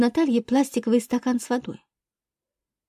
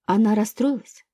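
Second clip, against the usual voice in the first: ordinary delivery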